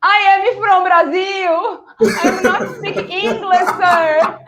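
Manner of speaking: high, slow, broken English played dumb; hammed-up helpless Brazilian tourist pleading with a cop; fake helpless foreigner. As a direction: act like you can't speak English, pleading. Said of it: "I said".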